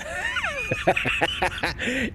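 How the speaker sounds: high, wheezing laughing fit; helpless laughter. Real, not acted. Streamer cracking up over moving to Baltimore, helpless laugh. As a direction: crack up at your own joke.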